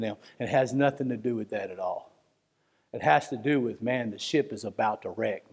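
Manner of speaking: low, hushed pastoral earnestness; hurt, sombre. Real, not acted. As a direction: drop low and soft, confiding the hurt. Preacher confides hurt at being misunderstood.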